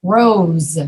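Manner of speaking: rose ending in a buzzing Z; voiced Z. Shown as a demonstration. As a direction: say rose, voice on through the final Z. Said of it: "That's a Z sound too".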